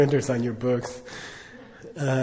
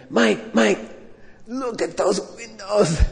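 higher, slow and eager; excited Lama; 6 semitones higher, slower than usual. Retold or imitated. Lama calling his student to look at windows, eager. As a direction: tug at the student's sleeve in wonder. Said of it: "he's like".

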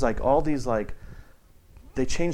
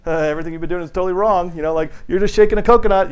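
higher, louder, tactless scolding; blunt teacher dropping hard truth, loud and high; blunt know-it-all; 7 semitones higher, 8 dB louder than usual. Retold or imitated. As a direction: hammer them with the bad news. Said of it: "drop this whole emptiness bomb".

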